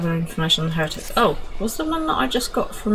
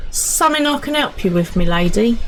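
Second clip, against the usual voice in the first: soldier NPC; 6 semitones higher, 4 dB louder than usual. Acted.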